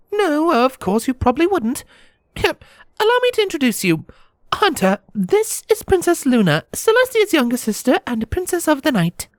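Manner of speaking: very high-pitched mayor making introductions; mayor's introduction. Acted. Mayor makes a formal introduction.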